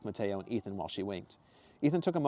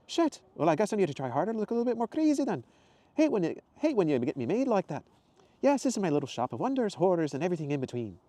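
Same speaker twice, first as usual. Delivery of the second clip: much higher, louder cheerful woman's voice; Zoe's exclamation; 9 semitones higher, 5 dB louder than usual. Acted. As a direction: greet him brightly and high. Zoe voiced.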